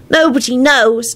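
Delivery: put-on slow, high, glum child voice; a sad kid answering; a touch of gloom, slowed; gloomy. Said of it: "Teddy responded gloomily".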